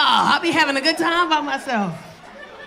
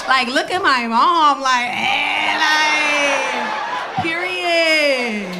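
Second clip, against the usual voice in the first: put-on chatty teenage daughter, louder, rising into a shout; imitating teen daughter; 4 dB louder, slower than usual. Comic mom plays her nineteen-year-old daughter, lightly voiced and loud. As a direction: rattle off questions like a nosy teen. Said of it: "She be like".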